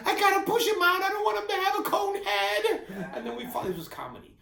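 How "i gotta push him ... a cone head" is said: loud, much higher push talk; determined wife. Retold or imitated. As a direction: urge yourself on mid-labour, loud and high. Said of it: "Tanya looks at me and she goes".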